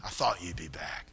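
very low, very soft ominous voice; demonic menace. Retold or imitated. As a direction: murmur it like a dark omen. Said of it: "I took it as a demonic thing".

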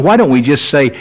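ordinary talk

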